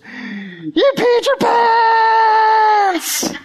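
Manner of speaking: very high screech with the last word held endlessly; loud kid yelling. Retold or imitated. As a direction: shriek it like a taunting little boy. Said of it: "he was a loud dude".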